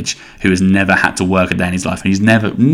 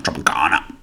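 Tropicana dropped lower and softer, rising at the end; low repeat; 6 semitones lower, 9 dB quieter than usual. Shown as a demonstration. Repeating the juice name to listeners, low and softer.

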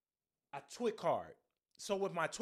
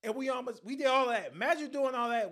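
loud rant; 5 semitones higher, 7 dB louder than usual. Real, not acted. Ranting about nearly missing flight.

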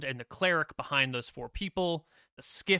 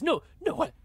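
high, stunned disbelief; shocked; 5 dB louder than usual. Acted. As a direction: gasp it out in shock.

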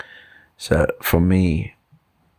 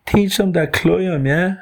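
put-on high-pitched Dublin accent for a monkey; 11 semitones higher than usual. Monkey pushes climbing lessons again, high Dublin voice. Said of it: "Mister Monkey was from Dublin".

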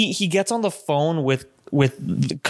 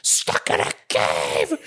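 mock scream; 12 semitones lower, slower than usual. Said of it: "watch him scream".

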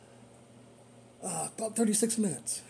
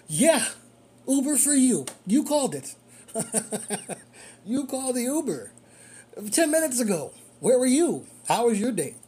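higher and louder sarcastic jab at a late rider; driver's pretend sarcastic greeting to a late rider, higher and louder; mock sarcastic retort; 6 semitones higher, 3 dB louder than usual. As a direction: needle the late rider with fake cheer. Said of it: "that's what you wanna say to 'em".

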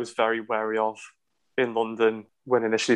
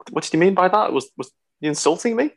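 puzzled indignation; 7 semitones higher than usual. Retold or imitated. Retells puzzled reaction to a colleague.

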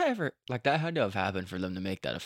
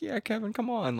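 much higher coaxing party voice; party friends coaxing; 13 semitones higher than usual. Retold or imitated. Retelling party guests urging Kevin to kiss, high coaxing voice. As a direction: egg him on like a tipsy guest. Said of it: "people at the party being like".

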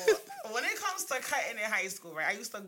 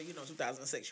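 normal talking voice

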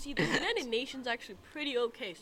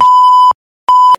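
yelling; 31 dB louder than usual. Real, not acted. Kid yells gleefully into mic.

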